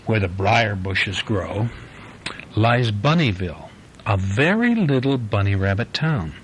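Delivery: gentle storybook read-aloud; reading to a child. Acted. Dad reading the Easter bunny book to his little girl, easy storytelling voice. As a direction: read it like a bedtime picture book for a little one.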